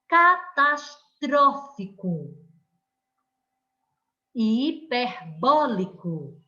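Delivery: teacher modelling proparoxytones aloud; stressed syllable demo. Shown as a demonstration. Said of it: "Lendo as palavras aí em voz alta e corretamente".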